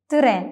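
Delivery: "തിറേൻ" with a short vowel between t and r; correct Turkish pronunciation. Shown as a demonstration. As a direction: split "തിറേൻ" into tı-ren. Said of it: "ഇ എന്നൊരു sound ഉൾപ്പെടുത്തി".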